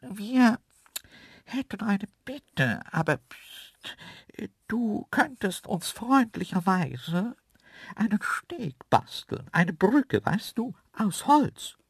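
high, slow, secretive animal voice; whispering second antelope. A narrator's reading. Second antelope asking the man to build a bridge, conspiratorial. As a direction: make a hushed-sounding, hesitant request up high. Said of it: "bis die zweite Stimme flüsterte".

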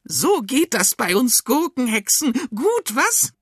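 very high, loud witch voice; gloating cucumber witch. A narrator's reading. Cucumber witch showing off to Arthur, gloating witch voice. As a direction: crow about your trick, high and witchy.

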